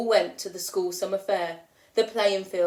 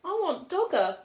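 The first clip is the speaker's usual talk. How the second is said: put-on small sad boy; 8 semitones higher than usual. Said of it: "he said".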